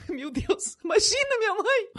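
laughing through the words. Real, not acted. Laughs recalling mother's call. (rindo de verdade)

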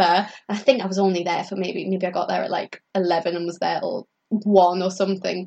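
normal talking voice

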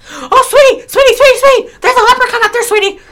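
shrieking warning in a put-on voice; Jennifer Aniston impression. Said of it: "And she says".

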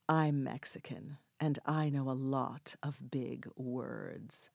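slow, low, deliberate retort; measured and pointed, every word drawn out; controlled anger. Retold or imitated. Mexican actress answers an insult, controlled anger.

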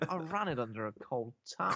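hushed stepdad imitation; Bill Nighy. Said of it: "That was a good Bill Nighy impression".

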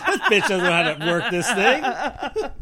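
lightly voiced, laughing, slowed mock rant; mock cursing. Teasing friend by imitating her cursing. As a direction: imitate her slow grumble through laughter. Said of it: "You went like this".

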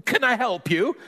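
boy pretending all is normal at the door; squeaky high voice. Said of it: "in a squeaky high voice".

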